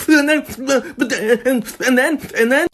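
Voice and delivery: high, halting mock sobs; imitated crying child. Mom imitates little daughter explaining herself, blubbering. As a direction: stammer like a tearful little girl. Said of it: "And so Katie starts crying".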